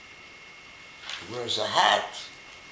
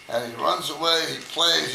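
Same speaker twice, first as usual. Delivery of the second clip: ordinary delivery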